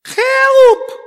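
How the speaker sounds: high, loud wailing cry; comic anguished wail; desperate cry for help. Shown as a demonstration. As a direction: cry out like a drowning man. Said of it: "le ha dicho en oración a Dios".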